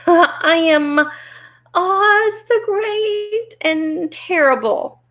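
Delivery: high, slow, trembling announcement. Acted. Little man names himself to intruders, trembling. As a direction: quaver it out slowly, high-pitched. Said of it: "in a trembling voice".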